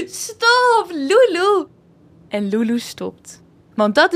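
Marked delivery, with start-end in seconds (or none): 0.0-1.7 giggling helplessly, much higher; laughing out a plea to stop; being tickled. Acted. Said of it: "tot hij niet meer kan van het lachen".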